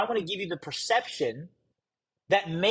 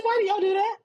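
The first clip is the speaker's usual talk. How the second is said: exasperated question; 3 dB louder, faster than usual. Retold or imitated. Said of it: "I said".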